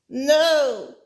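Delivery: sudden high, loud cry of shock; a sharp anguished no; cried out. A narrator's reading. Amy cries out at the tragic tale, shocked and loud. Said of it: "Amy cried".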